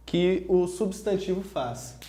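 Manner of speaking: usual speaking voice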